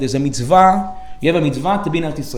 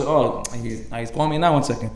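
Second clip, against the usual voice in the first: hushed aside; 5 semitones lower, 6 dB quieter than usual. Retold or imitated. Friend hushing before he answers the rabbi's call. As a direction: lower and softer aside.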